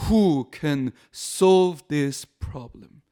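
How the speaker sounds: very slow, measured English line; steady, drawn-out divine answer. Acted. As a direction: spell out each word calmly as a reply. God's answer, quoted slowly.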